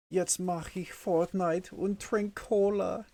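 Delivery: put-on high-pitched singsong German kid voice; mock German kid. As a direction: chirp it high like an eager child. Playing an excited German kid.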